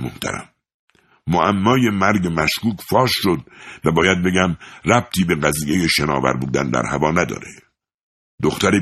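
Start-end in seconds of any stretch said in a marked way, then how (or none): none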